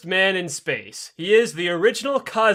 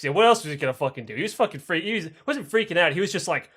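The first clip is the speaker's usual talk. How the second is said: ordinary talk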